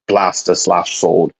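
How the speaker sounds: ordinary talk, nothing marked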